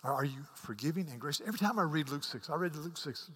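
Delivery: ordinary talk, nothing marked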